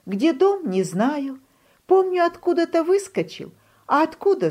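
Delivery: high small voice of a frightened duckling; lost little duckling. A narrator's reading. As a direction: play the chick high and helpless.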